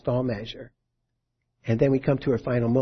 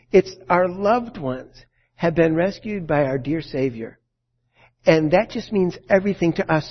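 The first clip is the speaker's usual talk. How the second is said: ordinary talk, nothing marked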